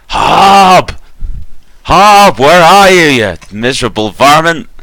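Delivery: huge, high, booming Texan holler; booming Texan yell. Acted. Mayor bellowing for Hob across town, booming Texan yell. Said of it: "yells out in her loudest booming Texan-style voice".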